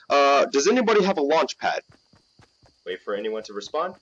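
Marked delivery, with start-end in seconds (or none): 0.1-1.8 loud radio-style request; loud mic call. Pretend. Gamer asking squad for a launch pad, loud mic call. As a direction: project it hard into the mic. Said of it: "That was quite loud".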